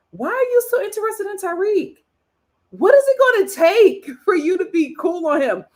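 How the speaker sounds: high, pleading mock scolding; exasperated disbelief. Viewer questioning Andriana about Tariq, exasperated disbelief. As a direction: demand an answer, pitched well up.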